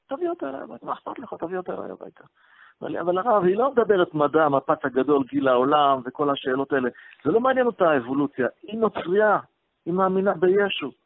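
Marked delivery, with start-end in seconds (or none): 0.1-2.2 "תביא אותה אליי הביתה" said much higher and pressing; eager insistence. Retold or imitated. Repeated invitation to the son. 3.0-10.9 worried protest. Retold or imitated. Son protests the fiancée won't listen.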